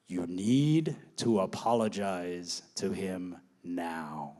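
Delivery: low, slow, grave psychic voice; stern mock medium. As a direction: hand down the order like a solemn medium. Spiritualist orders an apology.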